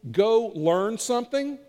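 slow, pointed put-down; insulting dig. Acted. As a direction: deliver each word as a slight. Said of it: "you're insulting them".

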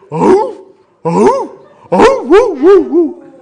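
high, loud woo-ing bay with a laugh; bay like the dogs outside the window; coon dog howl. Retold or imitated. Preacher mimics dogs heard all summer night, loud howl. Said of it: "all you hear is".

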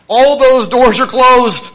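slow, loud, weighty declaration; emphatic and loud. Real, not acted. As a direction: land it slowly with full force. Emphatic climax of sermon point.

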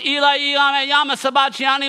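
high, loud scriptural cry. Acted. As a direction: call it out loudly as Jesus on the cross. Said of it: "in a loud voice".

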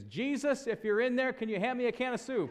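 put-on squeaky little kid calling into the pantry; timid child calling out. Scared child calls into pantry.

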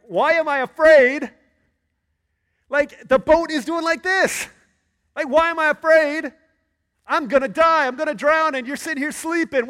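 high, loud, frantic disbelief; panicked disciple. Acted. Disciple yelling at sleeping Jesus in the storm, panicked high shout. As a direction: shriek it like a terrified fisherman in a sinking boat.

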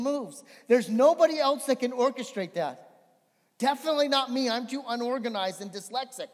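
loud, high preacher's voice driving the scripture home; full-force preaching. Acted. Pastor booming Job's words to the church, full-force preaching. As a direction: preach it out big to the congregation.

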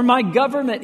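loud, high rhetorical outcry; imagined cry. Retold or imitated. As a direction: cry it out from the pulpit. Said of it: "He could have cried that out".

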